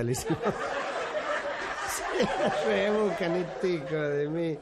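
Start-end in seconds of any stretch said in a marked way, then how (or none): 0.3-2.1 carcajada sin voz al rematar el chiste. Real, not acted. Se ríe de su propio chiste. 2.2-4.6 hablar lento, todavía riéndose; entre risas. Real, not acted. Comenta riéndose tras el remate.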